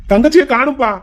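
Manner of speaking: alarmed outburst. Acted. Son tells father his sister is missing, alarmed. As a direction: blurt out bad news.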